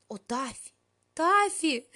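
high, loud anguished howl; wailing. A narrator's reading. Melanie howls at the cat over the torn doll clothes. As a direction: wail the name in despair. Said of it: "взвыла она".

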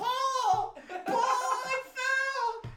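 very high, loud drawn-out wail; shrieky falsetto cry, hammed up; mock wailing. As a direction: imitate a helpless squeal for rescue. Imitating a tripped friend's wail.

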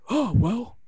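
meek backpedaling boss. Retold or imitated. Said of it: "And Johnny Ace goes".

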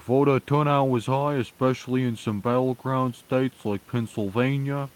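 high-pitched mock politician, Vermont accent; put-on accent, pitched well up; Bernie Sanders impression. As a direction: imitate an uncharismatic senator reporting results. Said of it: "He's like".

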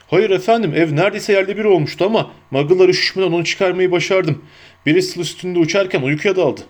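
lightly acted, eager giant reporting in; Hagrid the giant. Narrator voices Hagrid in dialogue.

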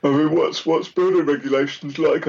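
words stretched by a yawn. Real, not acted. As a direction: talk through a yawn. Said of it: "Sorry, I'm yawning".

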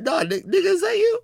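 very high, incredulous caller; mock disbelief. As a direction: imitate a caller suddenly doubting who answered. Acts out the confused caller.

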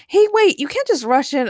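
high, loud protest; complaining. A narrator's reading. Jesse objects to the rush, complaining loudly. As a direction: call after them in exasperation. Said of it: "Jesse complained".

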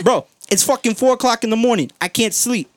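high, loud sleepless complaint; exasperated. Retold or imitated. Kept awake at four in the morning by workers, exasperated outburst.

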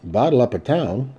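ordinary delivery

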